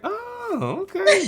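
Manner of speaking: high, surprised "oh, okay". Retold or imitated. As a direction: squeak it out in mock surprise. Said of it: "I was like".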